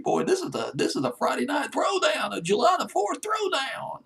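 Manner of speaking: high, excited radio hype call; hyped DJ shout. Real, not acted. DJ hyping listeners for the Friday night throwdown, excited shout. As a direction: whip the crowd up like a party DJ.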